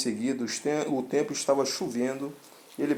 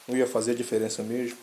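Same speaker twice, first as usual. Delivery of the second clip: plain reading voice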